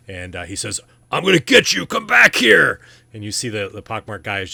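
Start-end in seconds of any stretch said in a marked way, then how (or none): 1.1-2.8 furious high shout from a big brute; yelled threat, loud and high-pitched; screaming. Acted. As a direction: bellow after someone running away. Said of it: "large gentleman sort of screaming at".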